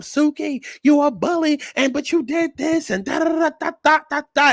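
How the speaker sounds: high, mock-whiny complaint; mocking Rolly's rant. Host mocking Rolly going off at Suki, high and whiny. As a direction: run it off high like a nagging rant. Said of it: "She talking about some".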